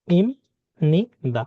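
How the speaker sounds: ইমনিদা drawn out very slowly, im-ni-da; slow model pronunciation. Shown as a demonstration. Teacher slows the word down for students. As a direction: stretch every syllable of ইমনিদা. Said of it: "মিয়মের মতো উচ্চারণ হবে।".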